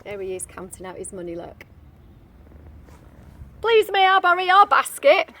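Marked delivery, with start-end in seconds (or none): 3.6-5.3 louder, higher polite ask; lightly voiced wolf asking. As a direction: ask hopefully. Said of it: "he said".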